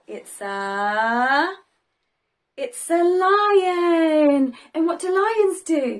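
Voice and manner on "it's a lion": very slow drawn-out reveal. Acted. Teacher revealing the lion to children slowly. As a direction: stretch it out for suspense.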